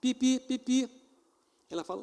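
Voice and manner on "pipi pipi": high imitated horn honk; playful horn noise; van horn beep.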